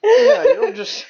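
laugh-crying, voice going high; shrieking through burning sinuses; after eating wasabi. Real, not acted. Reacting, nose on fire.

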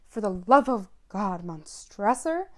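trapped man's desperate cry; desperate plea. Acted. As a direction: beg for your life from inside the wall. Fortunato's final plea.

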